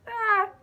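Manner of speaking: sudden high delighted squeal; excited squeal. Real, not acted. Squeals over tour shirt.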